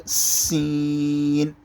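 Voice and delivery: سین with a sharp s, long ee held level; strong hissed سین. Shown as a demonstration. Teacher demonstrating the letter, سین sharp and drawn out. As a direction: hiss firmly into a stretched سین. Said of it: "اسی طریقے پر".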